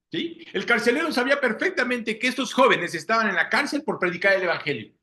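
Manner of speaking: normal talking voice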